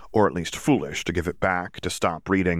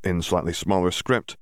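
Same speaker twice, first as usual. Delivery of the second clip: usual speaking voice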